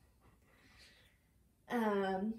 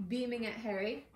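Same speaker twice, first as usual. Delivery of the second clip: ordinary delivery, nothing marked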